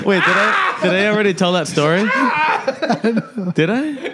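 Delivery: high, laughing did I; suddenly unsure, laughing; laughing surprise. Real, not acted.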